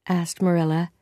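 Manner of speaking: plain reading voice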